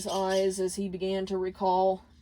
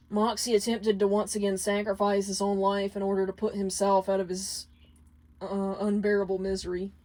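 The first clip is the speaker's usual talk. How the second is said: ordinary delivery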